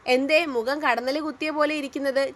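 ordinary delivery

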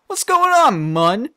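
high, loud suspicious demand; Drippy's squeaky challenge. Acted. Drippy demands the truth from the peddler, high and loud.

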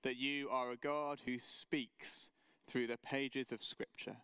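reverent pastor's prayer. Acted. Opening prayer before sermon.

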